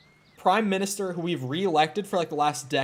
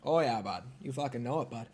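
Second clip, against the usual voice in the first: put-on low, soft, thick Canadian accent; exaggerated Canadian accent; 3 semitones lower, 7 dB quieter than usual. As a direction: play a laid-back Canadian, accent thick. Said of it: "a really strong Canadian accent".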